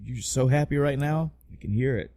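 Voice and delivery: ordinary talk, nothing marked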